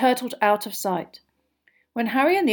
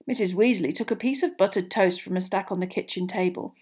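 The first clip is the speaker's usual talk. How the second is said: ordinary delivery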